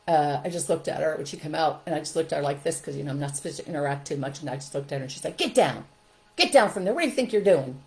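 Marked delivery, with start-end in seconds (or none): 5.4-7.8 loud, sharp teacher's reprimand; angry scolding. Retold or imitated. Teacher to child standing on rocking chair, scolding.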